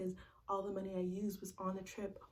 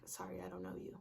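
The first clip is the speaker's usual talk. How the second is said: mock cold dismissal; 7 dB quieter than usual. Ex pretending not to know her.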